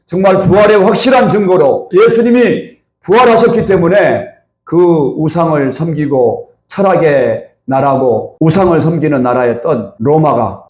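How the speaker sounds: normal talking voice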